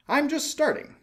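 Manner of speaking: very high, eager exclamation; high, loud and eager; excited cry. Acted. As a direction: call it out, itching to fly off. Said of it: "he cried".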